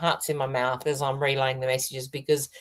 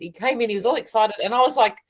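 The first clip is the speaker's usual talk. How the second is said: usual speaking voice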